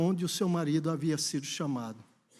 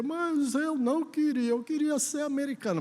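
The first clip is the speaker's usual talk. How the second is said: put-on high-pitched childish whine; whining child; 8 semitones higher than usual. Daughter to her father, wanting to be American, whining. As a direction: protest like a sulky little girl. Retold daughter protests, keeps crying.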